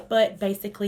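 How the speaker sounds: usual speaking voice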